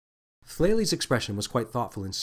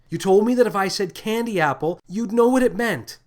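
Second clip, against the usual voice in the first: higher and louder, words tumbling out; blurted out; 9 semitones higher, 7 dB louder than usual. Acted. Lily blurts the code word to the captain, high and loud. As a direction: blurt it in a panicked rush. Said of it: "Lily blurted out".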